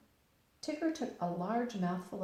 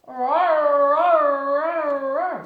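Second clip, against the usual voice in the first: big loud playful roar, higher; 8 semitones higher, 17 dB louder, much slower than usual. Acted. Tigger roaring outside Pooh's door at night. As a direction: roar like a bouncy tiger.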